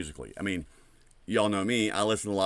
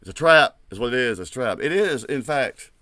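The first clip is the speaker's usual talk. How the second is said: excited shout; 4 semitones higher, 9 dB louder than usual. Real, not acted. Emphatic hyped reaction.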